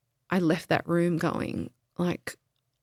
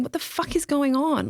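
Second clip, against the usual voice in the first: retold outrage; 8 semitones higher than usual. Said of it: "it was just outrage".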